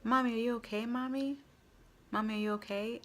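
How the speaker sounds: retold yelling. Son calls to struggling mom, retold worried yell. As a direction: call out to mom from the water, worried. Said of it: "he started yelling".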